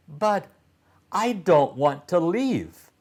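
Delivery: put-on a boy's voice pitched well up, whiny and pouty; pouting protest. A narrator's reading. Titus protesting to Harper that he won't leave, pouty and high. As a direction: pout and protest like a child. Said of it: "protested Titus with a pout".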